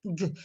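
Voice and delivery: "G" said as a hard "guh"; hard G sound. Shown as a demonstration. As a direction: say "G" as the sound guh, not the letter name. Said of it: "on peut prononcer le G".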